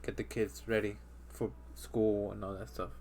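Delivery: usual speaking voice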